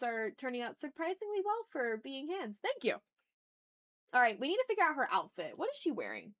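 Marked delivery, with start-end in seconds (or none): none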